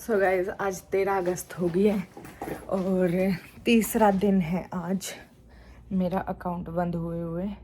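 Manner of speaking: low, subdued and slow, with heavy pauses; sad. Real, not acted. Telling viewers the account is shut, sad. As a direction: speak softly and slowly, downcast. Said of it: "I am so sad".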